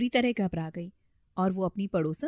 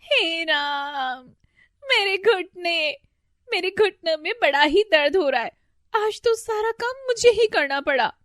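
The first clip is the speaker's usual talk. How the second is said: groaning in pain; 10 semitones higher, 6 dB louder than usual.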